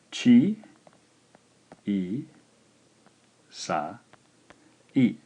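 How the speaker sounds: "Chi, i, sa, i" slowly separated, fading at the end; walk through each syllable. Shown as a demonstration. Teacher slowly repeats the tricky word for students: syllables. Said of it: "might have been a little tricky".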